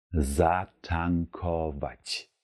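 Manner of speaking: Zatankować stretched slowly; very slow demo. Shown as a demonstration. Teacher slowly models zatankować for learners. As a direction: spell out Zatankować in slow syllables. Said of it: "heißt auf Polnisch".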